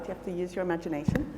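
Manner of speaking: ordinary delivery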